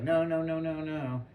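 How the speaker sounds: mild mock child protest; a touch of a stubborn little kid; kid denial. Little sister refusing to hear Santa isn't real.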